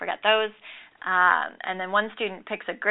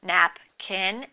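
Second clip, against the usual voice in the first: "Napkin" drawn out slowly, syllable by syllable, then joined into one word; blending syllables slowly. Shown as a demonstration. Teacher modeling for students how syllables blend, slow and deliberate. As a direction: slowly sound out "Napkin" for the class. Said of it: "I combine the syllables to form the word".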